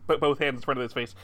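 normal talking voice

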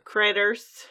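loud, drawled Texan "Critters"; Texan accent. Shown as a demonstration. Co-host asked for the accent, loud Texan accent. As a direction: hit "Critters" hard with a Texas twang. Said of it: "you gotta say it in the accent".

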